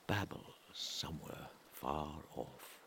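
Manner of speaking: ordinary delivery, nothing marked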